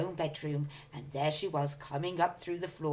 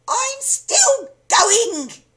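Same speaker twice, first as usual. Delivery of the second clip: put-on very high, loud, slow screech of an old hag; old screechy voice; 14 dB louder, much slower than usual. A narrator's reading. Grandma calling from the attic, old screechy voice. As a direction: stretch it out like a cackling crone. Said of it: "the old screechy voice".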